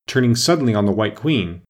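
plain reading voice

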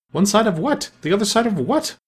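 high, baffled double question; puzzled questioning. A narrator's reading. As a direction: ask it twice, utterly puzzled. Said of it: "thought Alice to herself".

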